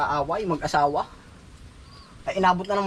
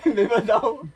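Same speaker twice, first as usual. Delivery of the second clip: high laugh into the question; real laughter at a teasing joke; 10 semitones higher than usual. Real, not acted. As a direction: laugh high, then ask through it.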